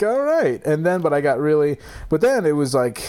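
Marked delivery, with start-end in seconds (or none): 0.0-0.6 high, loud all right; exasperated. Retold or imitated. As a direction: throw up your hands in exasperation. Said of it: "So I'm like".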